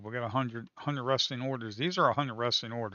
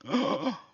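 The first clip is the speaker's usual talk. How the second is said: 1 sharp gasp; 7 semitones higher than usual. Real, not acted.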